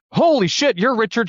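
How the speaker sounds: high and loud, blurting out the name in shock; starstruck outburst. Retold or imitated. Fan meeting Richard Childress, shocked high outburst. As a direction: gasp it out like you just saw a legend.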